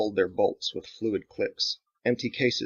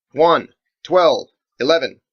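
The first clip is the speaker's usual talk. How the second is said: loud, higher target calls; calling targets; 6 semitones higher, 12 dB louder, slower than usual. Acted. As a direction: call it over the guns.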